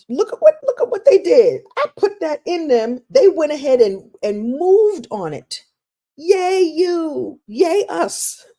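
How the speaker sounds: a proud cheer from above; divine cheering. Pretend. The divine cheering a person's achievement, high and loud with delight.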